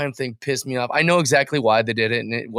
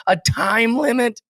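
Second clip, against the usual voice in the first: scornful disbelief; 10 semitones higher than usual. Real, not acted. Disgusted disbelief.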